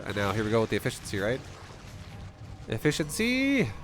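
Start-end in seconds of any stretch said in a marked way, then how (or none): 2.7-3.7 high, slow hammed-up shout; drawn-out excited cry. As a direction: stretch it out like a catchphrase. Cries out the buzzword.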